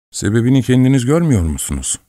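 ordinary delivery, nothing marked

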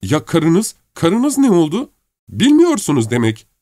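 high-pitched urgent questions; alarmed questioning. A narrator's reading. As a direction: press anxiously, voice pitched high. Anxious questioning about his wife. (telaşlı, tiz sesle sorarak)

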